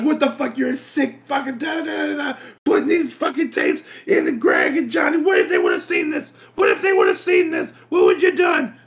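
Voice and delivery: put-on high, shouted old man tirade; retold furious scolding. Grandfather chewing out Wendell, imitated shouting rage. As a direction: bark it like an outraged grandfather.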